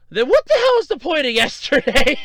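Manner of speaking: high, loud incredulous outburst; mock-outraged rhetorical question; exasperated bafflement. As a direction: cry it out in comic disbelief. Baffled at the decision's reversal.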